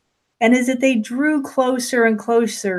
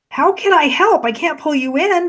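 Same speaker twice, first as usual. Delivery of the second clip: higher-pitched, mildly frustrated objection; exasperated protest; 6 semitones higher than usual. A narrator's reading. Woman protests she cannot help, mildly exasperated. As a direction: pitch jumps up in helpless protest.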